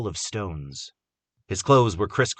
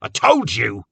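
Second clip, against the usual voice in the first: scolding repeat; 3 semitones higher, 8 dB louder, slower than usual. A narrator's reading. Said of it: "he repeated".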